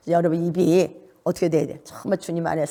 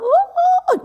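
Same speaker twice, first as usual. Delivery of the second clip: loud, high held "wow"; very high, drawn-out whoop; high note; 6 dB louder, much slower than usual. Shown as a demonstration. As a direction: let a wow soar way up high and hold it. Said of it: "어떤 막 고음까지 올라".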